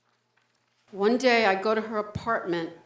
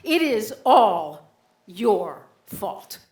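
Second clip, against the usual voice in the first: louder, slow accusing inner voice; lightly voiced scolding conscience; a hint of harsh inner critic; 5 dB louder than usual. Inner voice blaming him.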